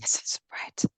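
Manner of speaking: tempting little whisper. Retold or imitated. Inner child tempting with bread.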